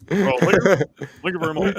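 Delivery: slurred [name] thank you, loud; sloppy drunk [name]. Retold or imitated. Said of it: "drunk [name] voice".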